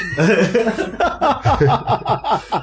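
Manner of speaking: a long high burst of laughter; real laughter rising high and falling away; hearty laugh. Real, not acted. Laughing at own story.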